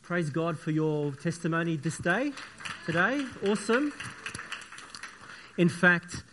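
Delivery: ordinary delivery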